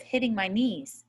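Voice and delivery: ordinary delivery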